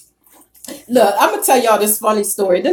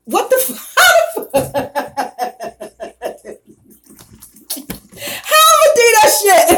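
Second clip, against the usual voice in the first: laughing; 8 semitones higher, 3 dB louder than usual. Real, not acted. Cracking up at her own demo.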